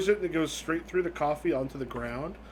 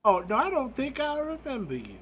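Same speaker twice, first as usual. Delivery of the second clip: put-on high, swooping old-lady voice; old woman ghost; 8 semitones higher than usual. Old woman peering through glasses at stranger, high and puzzled. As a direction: play a puzzled granny, pitched high. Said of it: "puts up some glasses and goes".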